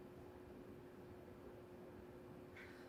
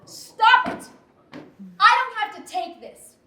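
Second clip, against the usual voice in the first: angry outburst, loud and high; raised voice; 13 dB louder than usual. Acted. Wife to husband, refusing to take this, raised angry voice. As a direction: snap back loudly at a higher pitch. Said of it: "Don't raise your voice".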